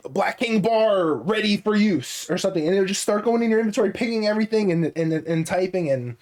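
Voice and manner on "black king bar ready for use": slow, high, robotic game ping; game announcer voice. Retold or imitated. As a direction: read it like an in-game alert. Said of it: "it says, like".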